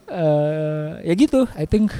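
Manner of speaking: ordinary talk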